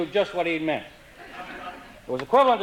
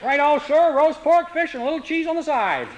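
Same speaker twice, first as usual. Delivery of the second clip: lightly voiced waiter; 9 semitones higher than usual. Waiter from Prince Edward Island replies.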